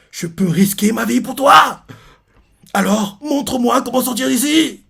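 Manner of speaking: slow, high, melodramatic plea; mock heroic vow. Reader parodies the suitor begging the way out, melodramatic. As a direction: ham it up as a devoted lover.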